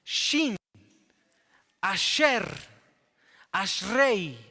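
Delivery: "Shin", "Asher", "Ashrei" each called out loud with a clear sh; pronunciation drill. Shown as a demonstration. Preacher drills Hebrew words aloud for the class, loud. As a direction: call out each Hebrew word loud and separate. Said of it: "la pronunciación".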